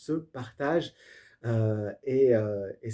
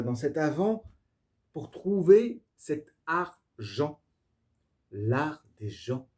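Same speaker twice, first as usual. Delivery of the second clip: normal talking voice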